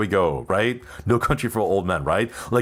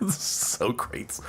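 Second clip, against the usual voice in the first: laughing. Real, not acted. Laughing whisper of wrong name.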